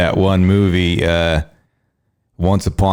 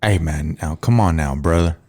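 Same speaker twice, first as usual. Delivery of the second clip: Texan accent; 5 semitones lower than usual. Shown as a demonstration. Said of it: "Can you say it again".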